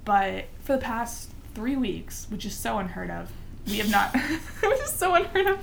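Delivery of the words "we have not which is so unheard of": words broken by laughter; laughing. Real, not acted. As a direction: laugh through the line. Laughing while speaking.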